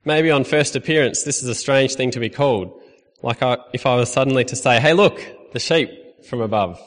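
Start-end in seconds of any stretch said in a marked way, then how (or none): none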